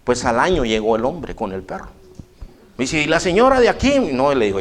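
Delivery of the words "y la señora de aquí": lightly higher, asking visitor; a hint of a puzzled stranger; man voiced higher. Stranger at the door asks for the lady, lightly higher voice.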